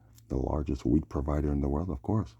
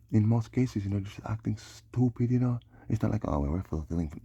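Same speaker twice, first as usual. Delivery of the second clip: worked-up rant; 7 semitones higher, 4 dB louder than usual. Real, not acted. Ranting about unknowing prophecy.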